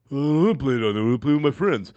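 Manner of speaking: mock kid's grumble; lightly voiced whiny son. Dad imitating his son pleading for the game, mild mock whine. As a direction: lightly imitate a kid making excuses. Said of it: "my son, being a pain in the ass".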